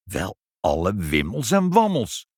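emphatic, astonished exclamation; flabbergasted. A narrator's reading. Father seeing the clock appear, flabbergasted. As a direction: react in utter amazement. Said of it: "riep vader totaal verbluft".

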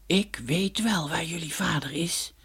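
slow, soft, otherworldly voice; cloud mannikin. Acted. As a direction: speak like a drifting cloud spirit. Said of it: "zei het wolkenmannetje". (traag en zacht als een wolkenmannetje)

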